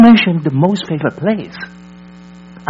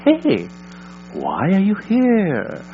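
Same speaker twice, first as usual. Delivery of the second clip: gentle prompting question; 4 semitones higher, 6 dB quieter, slower than usual. Shown as a demonstration. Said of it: "Is try to prompt them".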